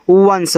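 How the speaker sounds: वंस repeated slowly and clearly; once repeated. Shown as a demonstration. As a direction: drill वंस for the class. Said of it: "क्या पढ़ते हैं?". (वंस दोबारा साफ़ बोलना)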